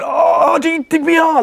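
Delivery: sarcastic falsetto jab at GDPR complainers; mocking whiny prospect. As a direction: squeak out the objection like a caricature.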